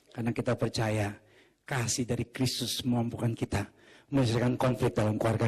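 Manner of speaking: usual speaking voice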